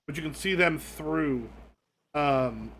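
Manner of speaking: normal talking voice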